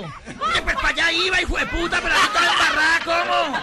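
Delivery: very high, very loud comic retort; outraged shrieking. Acted. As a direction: screech back in mock fury. La loca responde furiosa al conductor. (grito agudo de indignación exagerada)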